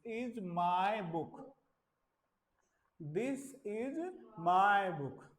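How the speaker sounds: very slow, each word spelled out; slow classroom demo sentence; slow teaching model. Shown as a demonstration. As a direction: drill it slowly for the class. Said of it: "तो ये कह रहा है".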